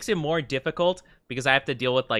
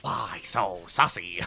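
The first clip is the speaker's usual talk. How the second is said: low Joker growl; 8 semitones lower, 4 dB quieter, slower than usual. Pretend. Joker line parody.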